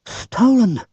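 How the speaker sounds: Bill finding his engine gone; gasped. Acted. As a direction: blurt it out in alarm. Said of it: "gasped Bill".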